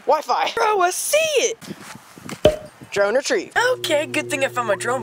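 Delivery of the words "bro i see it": high excited call. Real, not acted. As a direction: shout it when you spot it. Spots the lost drone.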